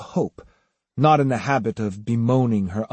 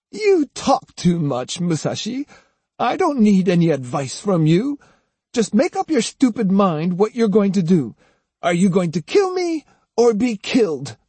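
put-on higher-pitched, angry old woman's scolding; scornful old woman; 6 semitones higher than usual. A narrator's reading. Bound Osugi defying Musashi as he frees her, angry scorn. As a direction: challenge him in a raised, scornful old voice.

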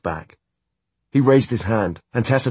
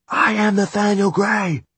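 high, loud proclamation; shouted up; 12 semitones higher, 3 dB louder than usual. A narrator's reading. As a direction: shout up at the giant. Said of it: "she shouted up at the metal giant".

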